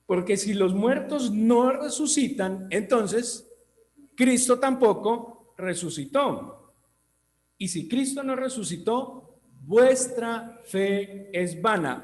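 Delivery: slow, high, emphatic preaching; slow fervent scripture reading. Acted. Preacher driving home the verse to the church, slow and high. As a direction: drag out each phrase for weight.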